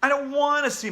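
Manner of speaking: high loud panicked cry; frightened yell. Acted. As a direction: yell it in fear. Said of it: "I screamed".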